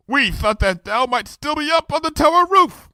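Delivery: high regal voice, archaic speech; royal Luna voice. Acted. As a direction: speak as a grand princess.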